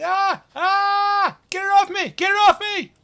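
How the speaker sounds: high panicked shrieks, full volume; screaming. Acted. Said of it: "so you hear this man screaming".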